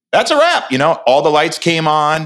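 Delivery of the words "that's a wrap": crew call. Retold or imitated. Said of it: "But then it was like".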